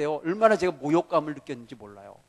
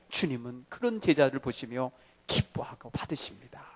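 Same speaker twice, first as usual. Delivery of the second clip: hushed whisper after shouting; whispered promise to the church; 5 semitones lower, 7 dB quieter than usual. Acted. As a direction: drop to a breathy secret. Sudden hush after loud preaching.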